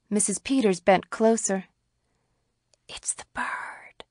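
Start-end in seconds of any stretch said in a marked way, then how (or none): 2.9-4.0 slow, hushed whisper. A narrator's reading. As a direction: whisper it slowly, barely there. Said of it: "she whispered".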